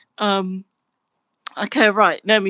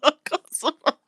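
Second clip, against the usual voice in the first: much higher voice, apologies squeezed out between laughs; laughing fit; 10 semitones higher than usual. Real, not acted. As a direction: try to apologise but keep cracking up. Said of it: "They've put me in a, like, laughing fit".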